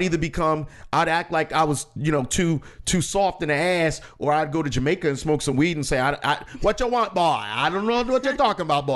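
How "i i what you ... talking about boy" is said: Jamaican accent, hammed-up to dodge the draft; fake Jamaican accent. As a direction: play dumb in a put-on Jamaican accent. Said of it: "Like, I would fake it".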